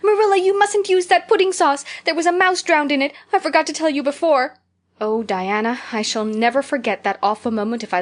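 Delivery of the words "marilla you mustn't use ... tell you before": high, loud shriek of alarm. Retold or imitated. Anne shrieking to Marilla across the dinner table. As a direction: blurt it out in a panicked shriek. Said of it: "stood up in my place and shrieked out".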